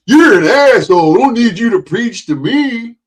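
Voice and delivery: loud, high put-on scoffing voice; mock viewer retort. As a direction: sneer it like a hostile viewer. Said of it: "You think".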